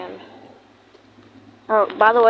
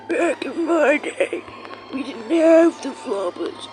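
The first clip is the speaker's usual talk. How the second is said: put-on slow, creaky old man voice; grandpa voice; 5 semitones higher, slower than usual. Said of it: "how much y'all rate my, um, grandpa voice".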